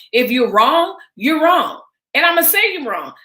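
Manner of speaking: rising indignant insistence; emphatic. Real, not acted. Heated rant about loyalty.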